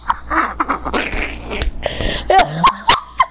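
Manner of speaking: long helpless laughing fit; real breathless laughing fit; weak with laughter. Real, not acted. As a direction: laugh till you have no strength left. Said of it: "Yo, I'm weak".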